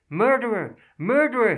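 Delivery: very high, loud repeated shriek. Acted. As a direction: scream the accusation at him. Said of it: "his wife was shrieking".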